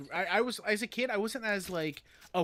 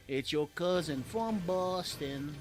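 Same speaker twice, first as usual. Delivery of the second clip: put-on Boston accent; slower than usual. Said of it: "got a Boston".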